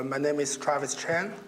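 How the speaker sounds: normal talking voice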